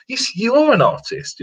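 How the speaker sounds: "are" drawn out and pitched high; stress on ARE. Real, not acted. Answering people who keep asking if they are artists, stress on "are". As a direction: lean slowly and high on "are" to insist.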